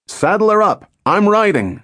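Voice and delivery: high-pitched eager shout. A narrator's reading. Pilot shouting to his ground crew before takeoff, high eager shout. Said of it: "shouted Tobin to his ground crew".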